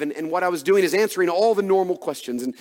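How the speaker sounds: ordinary talk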